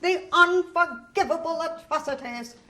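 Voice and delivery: loud, ranting old teacher mocked with a laugh; raging teacher. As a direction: rage on like the history teacher. Teacher raging about Union atrocities.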